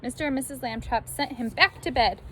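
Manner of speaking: usual speaking voice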